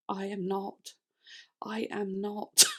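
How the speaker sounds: slow, quiet insistence; understated, deliberate self-contradiction; deadpan denial. Retold or imitated. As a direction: space out each word, softly. Mock denial of viewers' praise.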